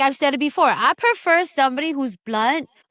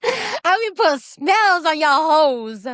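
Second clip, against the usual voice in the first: witch voice; 6 semitones higher, 3 dB louder, much slower than usual. Pretend. Witchy threat after evil laugh.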